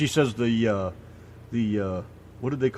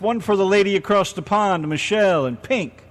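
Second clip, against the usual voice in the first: loud, high DJ shout-out; radio announcer; 6 semitones higher, 5 dB louder than usual. Acted. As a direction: hype up the station ID.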